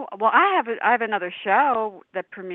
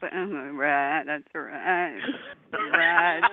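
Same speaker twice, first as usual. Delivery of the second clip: put-on old man voice; 6 semitones lower, 4 dB quieter, much slower than usual. Old man replying to nurse.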